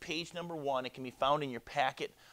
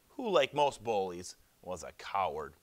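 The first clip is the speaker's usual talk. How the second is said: plain reading voice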